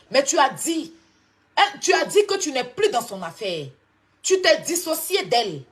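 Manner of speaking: loud and high, accusing; angry loud reproach at a rival. Real, not acted. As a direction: throw the accusation at her loudly. Heated clash on a live stream.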